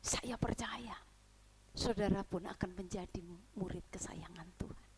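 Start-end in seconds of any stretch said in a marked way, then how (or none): 1.7-4.9 softer whispered promise. Real, not acted. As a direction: close with a tender whisper. Whispered closing blessing. (bisikan lembut)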